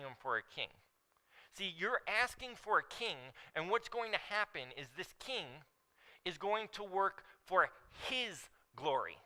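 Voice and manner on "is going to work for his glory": HIS held out and set apart in "for his glory"; slow down and lean on "his"; stress on HIS. Shown as a demonstration. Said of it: "there was an emphasis that I put".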